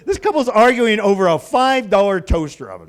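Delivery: ordinary talk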